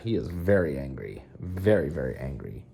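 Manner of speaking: deadpan doom voice; mock grave. Real, not acted. Owner mock-gravely narrating the angry cat, low.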